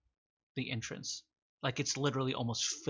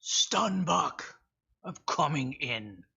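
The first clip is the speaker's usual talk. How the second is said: raised call in a put-on voice; yelling out; 3 semitones higher, 4 dB louder, slower than usual. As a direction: yell it into the cave, mildly. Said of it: "He merely just yells out".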